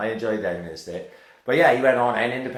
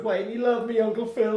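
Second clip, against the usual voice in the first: high, imitated sob-plea, much higher; mock-tearful, pitched way up like a kid breaking down; imitated crying plea; 13 semitones higher than usual. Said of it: "he bursts out crying".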